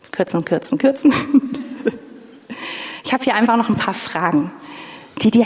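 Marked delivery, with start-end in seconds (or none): 0.1-1.1 muttering to herself. Real, not acted. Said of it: "will noch mehr kürzen". 1.2-2.0 short self-conscious laugh. Real, not acted. Laughs at her own cutting.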